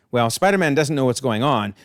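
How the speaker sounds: ordinary delivery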